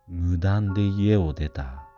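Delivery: plain reading voice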